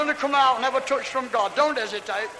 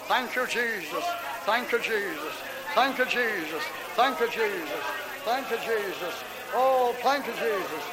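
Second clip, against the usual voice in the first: soft repeated thanks, quieter; murmured prayer; 3 semitones lower, 7 dB quieter than usual. Real, not acted. Preacher praying over seekers at the altar, softly murmuring. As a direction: breathe each thanks out softly.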